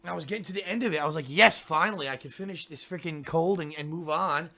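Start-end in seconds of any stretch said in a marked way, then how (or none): none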